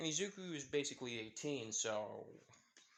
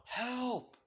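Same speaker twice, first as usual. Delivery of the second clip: high-pitched acted cry for help; yelling for help; 9 semitones higher, much slower than usual. A narrator's reading. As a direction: call out high, without real volume. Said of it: "she hears someone yelling out".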